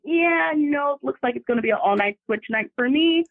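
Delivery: resigned sarcasm. Retold or imitated. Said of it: "So I was like".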